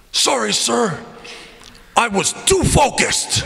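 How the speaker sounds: high-pitched imitation of a player; Boris. Player looks coach right in the eyes, put-on apologetic voice. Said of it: "I'm allowed to use his voice".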